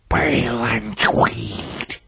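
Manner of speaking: slow, ghostly rasping hiss; strange hissing voice. A narrator's reading. Dead victims naming themselves to their killer, hissing. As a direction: hiss the names out slowly. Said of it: "hissing voice from Charles".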